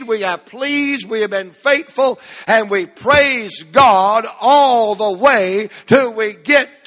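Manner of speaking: high-pitched pulpit build, stretching the key words; fervent sermon cadence; impassioned preaching. Real, not acted. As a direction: drive the refrain home like a revival preacher.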